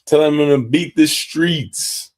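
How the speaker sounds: high, loud, drawn-out slogan; loud rallying call. Real, not acted. Host calling out the program's slogan to listeners, loudly. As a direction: belt out the slogan slowly, like a rallying cry. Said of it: "why that was so loud".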